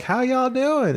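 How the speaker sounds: high-pitched mock Southern greeting; Southern accent. Co-host imitating someone greeting a woman, mock Southern accent. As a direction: put on a high Southern twang. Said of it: "hear someone talk that way".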